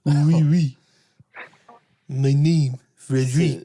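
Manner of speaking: playful mock French accent, Frederick whispered. Friends ask for a French accent, so a mock French one. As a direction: put on a hammy French accent. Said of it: "What about a French accent?".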